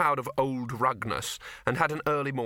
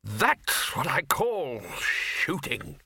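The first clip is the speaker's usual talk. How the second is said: very slow, weighty relish; raised, drawn-out pronouncement; blustering archchancellor; 4 semitones higher, much slower than usual. Acted. Archchancellor to bursar after pellets fly, emphatic.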